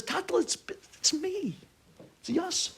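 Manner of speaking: high-pitched halting plea; pleading. Retold or imitated. Son to father who doesn't know him, high pleading.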